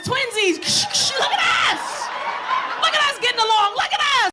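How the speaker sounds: high, loud, laughing glee; over-the-top delighted yelling; gleeful shouting. Retold or imitated. Mom gleefully yelling back.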